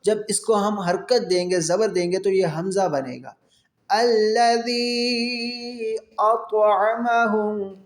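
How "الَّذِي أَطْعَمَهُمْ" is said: slow, drawn-out tajweed chant; تلاوت کا انداز; Quran recitation. Shown as a demonstration. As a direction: stretch the long vowels like a reciter. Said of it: "کھینچ کر پڑھنا ہے".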